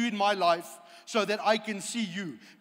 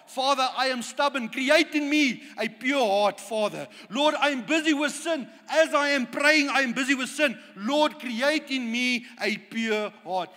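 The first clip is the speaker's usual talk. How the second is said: loud pleading confession, higher and forceful; David crying out; 4 semitones higher, 4 dB louder than usual. Acted. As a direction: beg God for a clean heart, full voice. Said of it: "David had an attitude to say".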